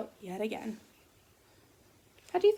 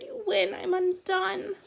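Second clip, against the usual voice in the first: higher, slow hammed-up sobs; mock sobbing; 8 semitones higher, slower than usual. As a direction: wail it out slowly, beaten. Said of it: "he blubbered".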